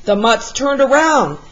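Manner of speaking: plain reading voice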